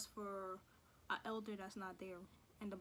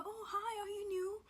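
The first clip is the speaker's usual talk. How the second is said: high, loud, gushing classmates; mock eager greeting; 10 semitones higher, 6 dB louder than usual. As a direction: squeal it like overeager kids meeting a newcomer. Imitates classmates greeting the new kid.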